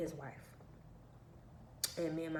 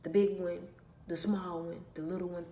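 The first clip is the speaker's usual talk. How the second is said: normal talking voice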